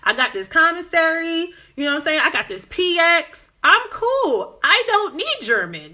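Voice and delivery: laid-back and cocky, no need for German; carefree brush-off. Retold or imitated. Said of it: "I was like".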